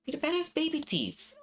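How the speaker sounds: put-on high, childlike girl voice; little girl voice. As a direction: speak as a small girl. Little sister speaks.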